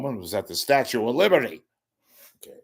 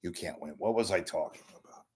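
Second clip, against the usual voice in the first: ordinary talk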